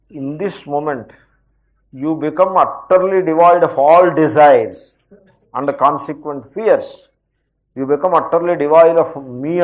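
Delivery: very slow, every word weighed; measured, grave teacher's proclamation; solemn slow declaration. Acted. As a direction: pronounce it like a blessing on the listener.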